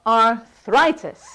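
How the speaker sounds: "arthritis" slowly, THRI stretched; model pronunciation. Shown as a demonstration. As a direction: break "arthritis" after AR, then lean on THRI. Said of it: "then you guys can do it yourself".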